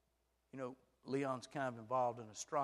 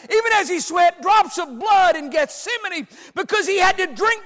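high, loud, impassioned sermon voice; preacher at the peak of his sermon; full-force preaching; 21 dB louder than usual. Acted. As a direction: thunder it out to the congregation.

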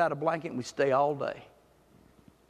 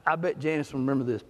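normal talking voice

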